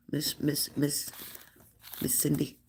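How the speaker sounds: soft repeated call for Miss Cindy. Real, not acted. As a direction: call her name gently and softly. Softly calling a chatter.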